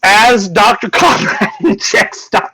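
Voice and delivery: normal talking voice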